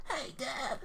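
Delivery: high, soft little-boy cartoon voice; Gohan. Son greeting his dad, high cartoon-boy voice. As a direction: pitch way up like an anime kid. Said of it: "the original Z voice".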